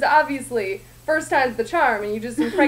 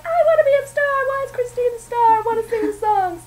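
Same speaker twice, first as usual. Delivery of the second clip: put-on much higher, whiny diva girl; whiny Meg; 10 semitones higher, 4 dB louder, faster than usual. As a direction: whine like a jealous wannabe star. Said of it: "And Meg's like".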